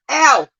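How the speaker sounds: sharp high cry of real pain; loud pained ow; pained yelp. Real, not acted. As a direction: yelp as the hurt hits. Real pain after injury.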